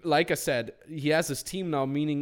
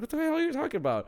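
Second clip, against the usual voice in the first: high, fast mock disbelief; incredulous; 13 semitones higher, faster than usual. Coach stunned at a client's revenue, high fast disbelief.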